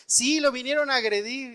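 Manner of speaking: high, loud accusing shout; neighbors yelling. Retold or imitated. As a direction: cry out the accusation to the street. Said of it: "empezaron a salir y a gritar".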